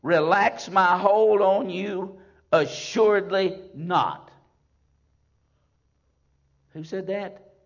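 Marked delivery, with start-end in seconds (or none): 0.0-4.3 loud, slow, ringing scripture reading; thundering sermon recitation; full-force preaching. Acted. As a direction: proclaim each promise slowly to the congregation.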